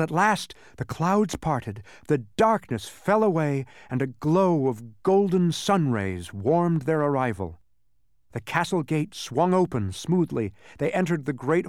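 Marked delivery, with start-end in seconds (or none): none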